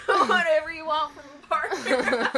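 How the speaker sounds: laughing hint of country accent; mock country accent. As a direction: drawl it laughing like a country gal. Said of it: "I can do country".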